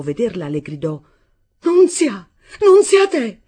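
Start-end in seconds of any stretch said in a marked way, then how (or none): 1.6-3.4 high, loud, anguished calling out; tragic cry. A narrator's reading. Mother crying out on first seeing her daughter, tragic. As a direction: cry the name out in high-pitched tragedy. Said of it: "con un accento così tragico".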